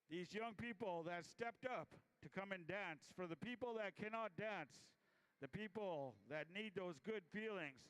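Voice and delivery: normal talking voice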